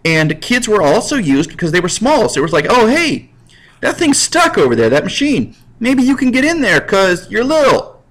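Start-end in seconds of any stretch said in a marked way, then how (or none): 2.7-8.0 lightly voiced boss. Boss sends kid into machine.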